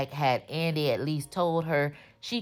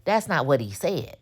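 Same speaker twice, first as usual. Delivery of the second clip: ordinary talk, nothing marked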